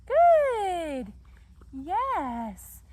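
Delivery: very loud, high, stretched-out praise; lavish praise. Real, not acted. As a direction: cheer the puppy on at full volume. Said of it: "lots and lots of praise".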